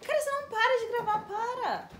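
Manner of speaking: high, hurried complaint; irritated high-pitched protest; annoyed. Real, not acted. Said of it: "tá com raiva?".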